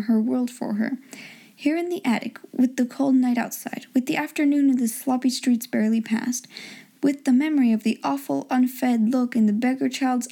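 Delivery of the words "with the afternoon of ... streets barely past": plain reading voice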